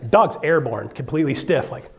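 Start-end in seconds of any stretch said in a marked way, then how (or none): none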